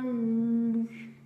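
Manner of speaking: "lu" stretched out very slowly; syllable demonstration. Shown as a demonstration. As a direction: hold the L of "lu" for a child. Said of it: "fais-moi". (« lu » très allongé, L tenu)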